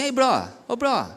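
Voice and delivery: lightly voiced youth calling a friend, rising; a pastor calling a friend out casually; casual friendly calling. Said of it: "a gente se chama assim".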